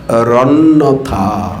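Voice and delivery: রন্যথা said slowly with the r joined on, low and falling; correct sandhi pronunciation. Shown as a demonstration. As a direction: link the visarga as r: রন্যথা. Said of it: "অতএব রটা উচ্চারণ করতে হবে তো".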